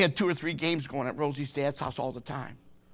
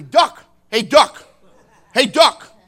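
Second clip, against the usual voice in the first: calling out; 7 semitones higher, 13 dB louder, slower than usual. Retold or imitated. Calling out a nickname.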